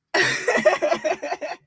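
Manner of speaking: high, breathy cackling; real laughter. Real, not acted. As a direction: crack up in a long high laugh. Cracks up at the jab.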